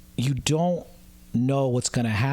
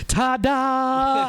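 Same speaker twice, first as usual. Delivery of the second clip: high, loud showman's ta-da; 9 semitones higher, 3 dB louder than usual. Retold or imitated. Comic on stage flourishing for a silent crowd, hammed-up ta-da. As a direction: sell it like a desperate tap dancer.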